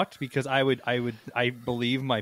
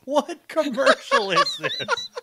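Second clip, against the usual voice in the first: laughing; 3 dB louder, slower than usual. Real, not acted. Laughing while talking.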